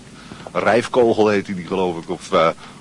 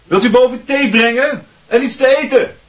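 much higher and louder call through the house; 14 semitones higher, 5 dB louder than usual. Acted. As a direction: call up the stairs to mother. Calling upstairs to his mother. (luid roepend door het huis)

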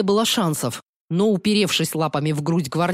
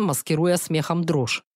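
ordinary delivery, nothing marked

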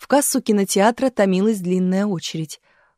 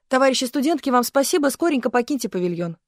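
высоко и громче, верещит; выпроваживает девушек, повизгивая; визгливо; 5 semitones higher, faster than usual. A narrator's reading. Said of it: "заверещал второй режиссёр". (второй режиссёр верещит, выгоняя студенток)

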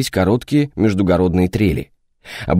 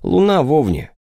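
mocking sympathy; 6 semitones higher than usual. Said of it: "с глумливым соболезнованием".

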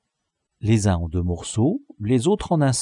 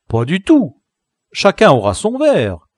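higher, louder scornful reply; disdainful; 7 semitones higher, 7 dB louder than usual. A narrator's reading. La crique dismissing the gang's plan, disdainful. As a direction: brush them off with scorn. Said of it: "dédaigneusement".

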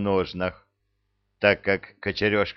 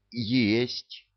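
forced out; 3 semitones higher than usual. A narrator's reading. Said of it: "с трудом выдавил из себя".

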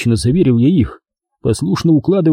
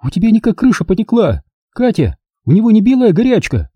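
agitated woman; 9 semitones higher than usual. A narrator's reading. Valya worried about him.

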